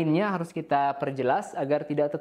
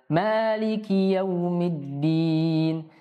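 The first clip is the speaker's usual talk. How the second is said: very slow chanted verse; Quran recitation; much slower than usual. Shown as a demonstration. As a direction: intone the whole verse slowly.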